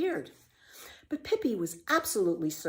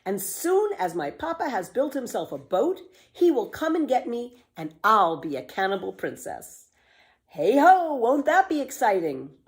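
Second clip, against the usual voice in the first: high, building to a loud "Hey ho"; excited; 5 semitones higher, 5 dB louder than usual. A narrator's reading. Pippi boasts about her cannibal king papa, growing excited and loud. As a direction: gush about becoming a princess.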